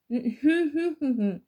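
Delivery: loud laugh breaking out; big laugh; burst of laughter. Acted. Laughing again at Mats.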